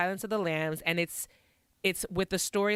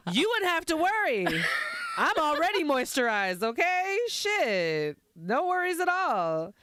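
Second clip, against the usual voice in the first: sassy; 8 semitones higher than usual. Pretend. Sassy reply to friend.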